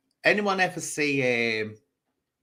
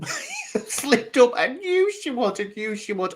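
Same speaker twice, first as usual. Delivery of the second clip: high-pitched, triumphant through laughter; gleeful laughing; 12 semitones higher, slower than usual. Real, not acted. Woman recalling a rival's slip-up, gleefully laughing. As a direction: crow it while laughing.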